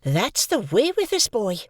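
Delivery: high, slow, exasperated; exclaiming sister. A narrator's reading. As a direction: exclaim about the boy. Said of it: "exclaimed my sister".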